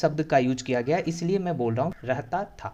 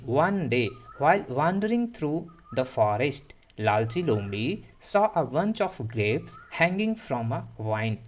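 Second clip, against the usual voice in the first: very slow, word by word; slow model reading; much slower than usual. Shown as a demonstration. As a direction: read it slowly so the class can follow. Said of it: "teacher reads sentence aloud". (बहुत धीमी पढ़ाई)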